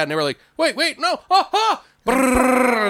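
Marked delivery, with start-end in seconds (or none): 0.6-1.8 very high mock yelps of terror; hammed-up last-second pleading; panicked pleading. Plays caller begging before being shot. 2.0-2.9 high rolled brrr of machine-gun fire; trilled gun noise; imitated gunfire.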